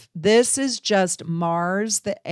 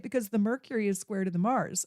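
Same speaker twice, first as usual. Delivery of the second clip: ordinary talk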